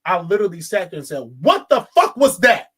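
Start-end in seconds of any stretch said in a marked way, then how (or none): 1.4-2.7 loud, higher outburst; indignant yell, punched word by word; retold yell. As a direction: yell it out like you're back in the cinema. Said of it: "audibly yelled out".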